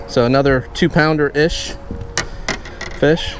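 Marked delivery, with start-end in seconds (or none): none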